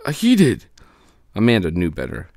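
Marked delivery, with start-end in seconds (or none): 0.0-0.7 nervous lie. Acted. Mars Bar lies nervously.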